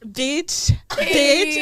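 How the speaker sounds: imitating an offended double take; shocked repeat. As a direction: repeat the misheard word in disbelief. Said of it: "This guy was like".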